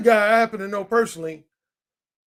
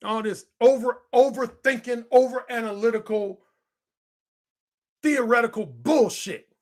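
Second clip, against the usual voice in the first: ordinary talk, nothing marked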